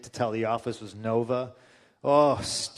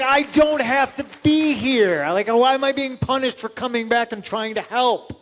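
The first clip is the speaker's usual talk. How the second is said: exasperated outburst; 9 semitones higher, 7 dB louder than usual. Retold or imitated. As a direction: plead in loud frustration. Exasperated protest.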